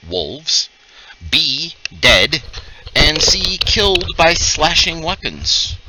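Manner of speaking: slow, deliberate scene-setting with pauses; drawn-out game master reveal; game master narration. A narrator's reading. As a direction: reveal the findings piece by piece.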